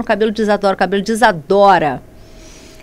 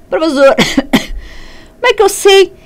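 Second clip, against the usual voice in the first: student voice; 7 semitones higher, 4 dB louder than usual. Pretend. Teacher voices a student's question.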